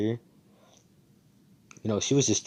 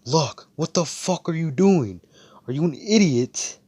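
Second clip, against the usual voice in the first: mock angry yell; 9 semitones higher, 7 dB louder than usual. Asta yelling at reckless Luck mid-fight, exasperated shout. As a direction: yell at him like he's lost his mind. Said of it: "Asta's like".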